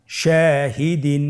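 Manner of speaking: Shahdeen in one connected run with long vowels; measured, unbroken Shahdeen; whole word joined. Shown as a demonstration. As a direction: join Shahdeen slowly without stopping. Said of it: "ভেঙে ভেঙে পড়ব".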